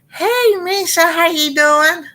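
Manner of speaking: high, bright singsong hello; light up and sing out a hello; cheery greeting. Real, not acted. Greeting a live-chat viewer by name, high sing-song hello.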